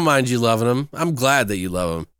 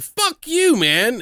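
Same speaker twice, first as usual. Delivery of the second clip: angry retort; 12 semitones higher, much slower than usual. Pretend. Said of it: "I'd be like".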